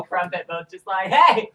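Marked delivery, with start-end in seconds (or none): 1.1-1.5 excited shout. Acted. Greeting called out loud.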